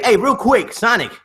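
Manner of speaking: normal talking voice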